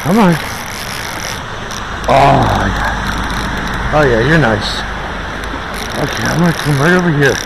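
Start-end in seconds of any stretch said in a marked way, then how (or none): none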